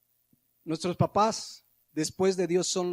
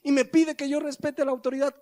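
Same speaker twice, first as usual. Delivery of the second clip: put-on high, louder child protesting; the kid points out the hypocrisy, pitched up high; child complaining; 9 semitones higher, 3 dB louder than usual. Son to his father, a high mock-whiny complaint. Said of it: "el hijo va a decir".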